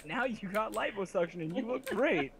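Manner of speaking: high-pitched mock sweet-talker; hammed-up flatterer. Showing how to compliment a former fat person, hammed-up flattery.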